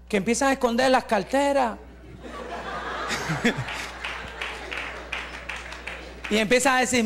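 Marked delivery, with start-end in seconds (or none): 2.2-6.0 a soft, unvoiced laugh under the breath; hushed chuckle at the memory; breathy silent laugh. Real, not acted. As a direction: laugh quietly to yourself. Laughs at people hiding purses.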